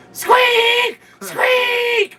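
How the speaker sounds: very high shouted squeak; squeaky horn voice. Pretend. Co-host replacing the honk with a squeak, very high shouted squeal. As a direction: squeal it like a tiny toy horn.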